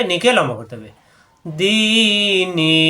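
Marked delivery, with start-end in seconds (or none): 1.5-2.8 দিনি with the long ি drawn out slowly, recitation style; stretched vowel. Shown as a demonstration. Teacher stretching দিনি slowly for the students. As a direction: hold the vowel in দিনি for a full beat. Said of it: "এই দিকে লম্বা করতে হবে".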